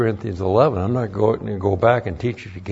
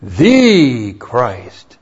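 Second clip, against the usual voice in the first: "The" punched louder and drawn out before "Christ", falling; stress on THE; 6 dB louder than usual. Shown as a demonstration. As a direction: hit "The" hard to show English emphasis. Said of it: "That's emphasizing".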